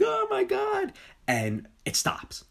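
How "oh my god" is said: very high mock scream; imitated terrified cry. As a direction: act out a panicked victim. Acting out Pete's terrified cry.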